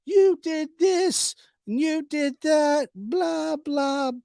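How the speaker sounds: high, slow whiny mock voice of the commenters; mocking critics. Vlogger mocking his commenters in a high whiny voice. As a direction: sneer it like an outraged commenter. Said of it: "people have been emailing me, commenting".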